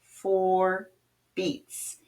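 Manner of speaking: slow, drawn out over the beats; slow counting voice; stretched out. Shown as a demonstration. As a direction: stretch the words to show length. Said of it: "make sure it stretches over".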